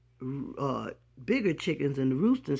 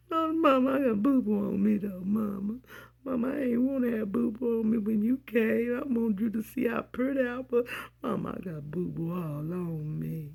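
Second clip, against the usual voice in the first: put-on much higher childish whine; whiny little girl; 6 semitones higher than usual. Little girl pleading with mama about the mess. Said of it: "child pleading to mother".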